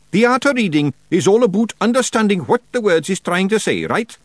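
high, loud broad Scottish wee man; a cocky little pixie laying down the law; Rob Anybody Feegle. A narrator's reading. Rob lectures.

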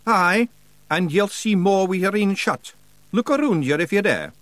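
high Scottish character voice; thick Scots, egging the listener on; Rob Anybody, daring Scots. Acted.

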